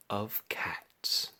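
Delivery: breathy whisper on the last words; whispered title. A narrator's reading. Narrator whispering the book title. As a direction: hush it like a secret.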